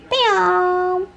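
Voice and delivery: very high, slow cooing voice; high sing-song pet talk. Real, not acted. Cooing to the dog.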